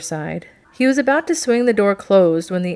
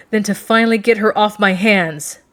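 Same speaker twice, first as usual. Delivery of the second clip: louder, emphatic angry outburst; angry exclamation; 3 semitones higher, 7 dB louder than usual. A narrator's reading. Captain exclaims about the woman he escorts, angry outburst. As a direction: exclaim it in anger, hitting the words hard. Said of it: "then he turned angry".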